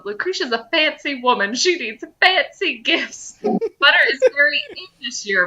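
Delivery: a dwarf calmly justifying the butter gift; matter-of-fact. Acted. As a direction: explain it like it's obvious. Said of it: "Merle says matter-of-factly".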